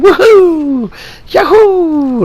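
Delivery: very high, loud game-character whoops; Mario. Retold or imitated. Imitating a game hero jumping through paintings, high gleeful whoops. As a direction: whoop it out in a squeaky falsetto, holding the last vowel. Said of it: "is Mario jumping in and out of paintings".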